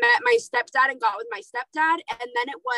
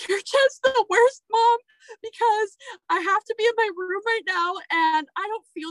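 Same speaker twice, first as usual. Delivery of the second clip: put-on whiny sick kid; 8 semitones higher than usual. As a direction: whine it like a kid sent to his room. Son whining at mom.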